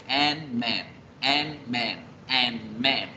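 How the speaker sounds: "and man" split in two, halting after the n; pausa entre consonantes. Shown as a demonstration. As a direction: stop on the n, then restart on man in "and man". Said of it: "me toca que pausar para decir la otra consonante". ("and man" entrecortado, pausando tras la n)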